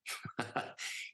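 soft breathy chuckle; short low laugh to himself; amused chuckle. Real, not acted. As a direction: chuckle quietly at the memory. Amused recalling friend's blunt remark.

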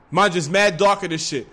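usual speaking voice

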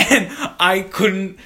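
laughing. Real, not acted. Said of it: "giggling to myself".

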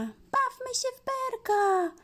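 high squeaky little mouse voice. Acted. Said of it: "Myszka pisnęła".

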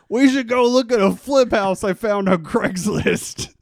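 loud, high-pitched tipsy excitement, retold; drunken enthusiasm. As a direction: blurt it out like an excited drunk, hammed-up. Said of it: "I was drinking and I was on Craigslist".

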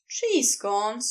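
usual speaking voice

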